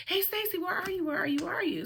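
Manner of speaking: high, singled-out calls without real volume; imitated calling friend. Monica looking for her in the store, imitated high calling. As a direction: mimic someone calling around a store.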